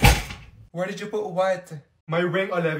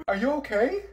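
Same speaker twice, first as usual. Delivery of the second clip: ordinary talk, nothing marked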